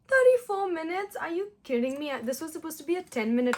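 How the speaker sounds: high, loud disbelief at the clock; shocked disbelief. Real, not acted. Telling viewers how long the makeup took, real shock. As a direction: gasp it out, can't believe it.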